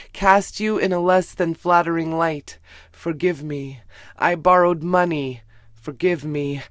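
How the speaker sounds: usual speaking voice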